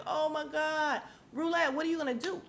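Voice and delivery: high, urgent plea; exasperated reaction. Retold or imitated. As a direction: urge her to act, pitched right up. Her exasperated reaction to the scene.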